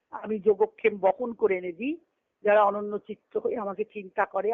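usual speaking voice

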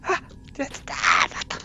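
high wailing groan of dismay; dismayed cry. Real, not acted. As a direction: wail over the mistake. Fell again in the game.

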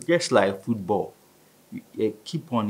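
ordinary talk

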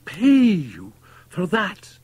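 high, loud, drawn-out outcry; slow, appalled high cry; incredulous cry. A narrator's reading. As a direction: stretch the cry out in disbelief. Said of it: "he cried".

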